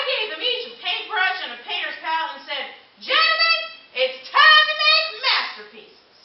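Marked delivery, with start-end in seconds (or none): 3.0-6.2 slow, high, louder grand pronouncement; grandly addressing cavemen. Acted. Time traveller to cavemen, slow grand proclamation.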